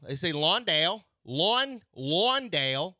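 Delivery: Londale with an open o, like lawn; Australian-style Londale. Shown as a demonstration. Host showing how Australians say it, Londale like lawn. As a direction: stretch the first syllable of Londale to lawn. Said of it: "when they speak it, they don't say Landale".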